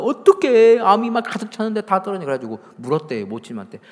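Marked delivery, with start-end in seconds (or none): 0.0-2.2 high-pitched astonished wondering; baffled curiosity. Retold or imitated. Doctor puzzled by the vanished cancer, baffled curiosity. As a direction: wonder aloud in raised, puzzled disbelief. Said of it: "의사가 막 너무 궁금한 거예요".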